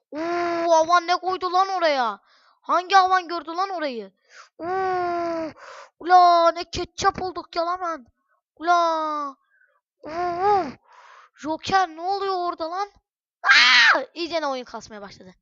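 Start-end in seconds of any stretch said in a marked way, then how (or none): 0.1-12.9 higher, louder cries of dismay; alarmed outcry. Real, not acted. Dismayed as the game attack goes wrong. 13.4-14.0 very high, loud sudden scream; shocked scream. Real, not acted. Shocked by what happens in game.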